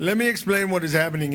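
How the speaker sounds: loud pilot announcer voice; helicopter pilot voice. Pretend. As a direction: play a chopper pilot briefing passengers. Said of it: "in my best helicopter pilot voice".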